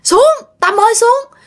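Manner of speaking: high-pitched alarmed call upward; startled calling. Retold or imitated. Mother spots friend atop banyan tree, startled calling. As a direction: imitate spotting a friend up high and urging her down. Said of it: "mẹ mới kêu".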